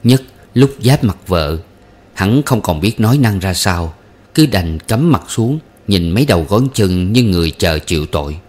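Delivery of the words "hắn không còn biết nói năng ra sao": ordinary delivery, nothing marked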